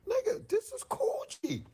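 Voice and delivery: very high, cracking squeal of disbelief; excited squeal. Retold or imitated. Pointing out the Coogi to Jay, squealing high. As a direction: let your voice break upward with excitement.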